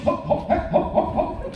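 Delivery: high, laughing hop hop grunts on each step; stair effort noises. Retold or imitated. Comedian mimicking his noises running up the stairs, high laughing grunts. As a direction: puff out little hops as you bound up the stairs. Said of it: "I have to make a noise".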